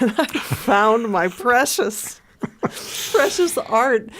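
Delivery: slow, hammed-up wounded child; milk the hurt for laughs; playful melodrama over a small betrayal; mock tragic. Mock tragedy of childhood slight.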